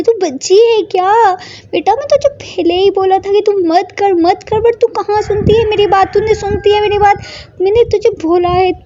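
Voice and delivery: high, loud scolding mother; mother shouting. Acted. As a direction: yell at the careless daughter. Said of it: "बहुत shout करती है". (माँ की ऊँची आवाज़ में ज़ोरदार डाँट)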